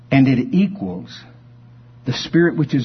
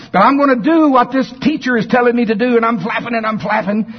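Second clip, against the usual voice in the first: high, fast, loud chicken voice; determined chicken; 11 semitones higher, 4 dB louder, faster than usual. Acted. Chicken Chuck vows to follow the teacher duck, high and eager. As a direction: pitch up and hurry, eager chicken.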